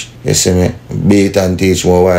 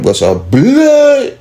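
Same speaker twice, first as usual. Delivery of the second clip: high, slow, loud imitated stab noise; mock stab sound; 3 dB louder, much slower than usual. Telling how he was stabbed, a high drawn-out mock stab noise. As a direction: stretch it out like the blade going in.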